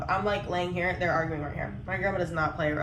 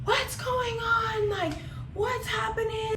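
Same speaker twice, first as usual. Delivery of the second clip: imitated scream; 13 semitones higher, slower than usual. Grandma comes out of the bathroom, imitated high scream. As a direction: imitate a panicked cry, drawn out way up high. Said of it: "she is screaming".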